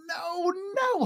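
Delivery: high, stretched-out hyena voice; grinning hyena. A narrator's reading. Hyena correcting the others about the sky, grinning high voice. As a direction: sing it out high and amused. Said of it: "Hyena grinned".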